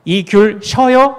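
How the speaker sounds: slow, careful Seoul Korean question; standard Seoul speech. Shown as a demonstration. Pastor modelling how Seoulites ask if tangerines are sour, slow Seoul speech. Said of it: "우리 이제 서울말".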